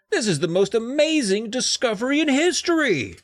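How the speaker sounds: high, loud boast; excited exclaim. Acted. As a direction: crow over the find. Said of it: "Dad exclaimed".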